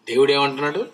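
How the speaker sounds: ordinary talk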